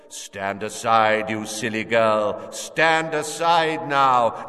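slow, cold commanding voice; menacing villain. Acted. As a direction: order her aside with slow menace. Villain orders mother aside.

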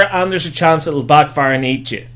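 ordinary delivery

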